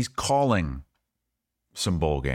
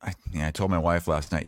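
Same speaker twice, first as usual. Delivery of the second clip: normal talking voice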